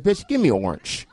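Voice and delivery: put-on Cleveland accent. Customer orders from the clerk, mimicked Cleveland accent. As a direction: squash "orange" the Cleveland way. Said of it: "that's how they talk in Cleveland".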